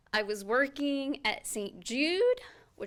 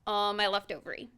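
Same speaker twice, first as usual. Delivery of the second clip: normal talking voice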